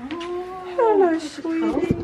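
high, soft coo; cooing to puppy. Real, not acted. Greeting the new puppy.

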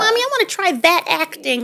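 put-on a milder echo of the kid's request; childish voice. Mother voicing small son again.

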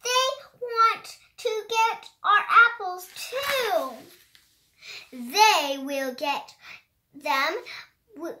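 high, lively storybook read-aloud; reading to children. Acted. A child reading a rhyming book aloud, high and animated. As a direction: bounce through the rhymes like a picture book.

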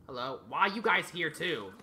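normal talking voice

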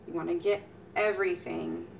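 ordinary delivery

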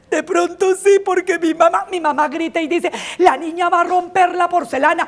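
put-on a kid blurting out her fear; mild child panic; anxious girl. Worried girl quoting mom.

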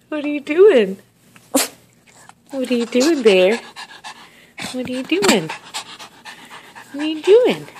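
cooing pet talk. Real, not acted. Owner coos at pet Bear, high sing-song.

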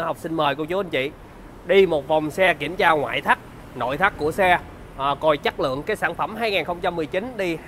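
bright, upbeat sales pitch; slick used car salesman. Acted.

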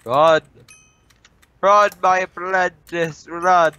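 high, slow dramatic command; mock mentor urging. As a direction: cry out like a grand master. Urging teammate to flee.

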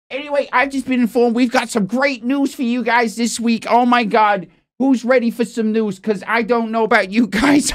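loud, upbeat show-host hype; rally the audience for the news; hyped news presenter. Acted. Host announces great news to viewers, hyped.

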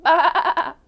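loud, high, wavering bleat; sheep baa. A narrator's reading. Storyteller recapping the duck's sound for children, loud baa. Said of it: "The duck said".

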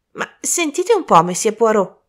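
high-pitched, puzzled interjection; still perplexed. A narrator's reading. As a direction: break in on Poirot, baffled. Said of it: "disse Colin ancora perplesso". (perplesso, a voce acuta)

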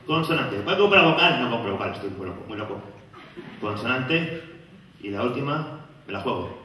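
low, soft dazed game-show player; a hint of a confused quiz contestant; mumbling contestant.

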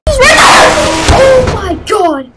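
long high terrified wail; panicked scream. Real, not acted. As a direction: scream it out in fright.

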